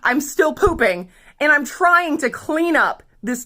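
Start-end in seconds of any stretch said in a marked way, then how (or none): none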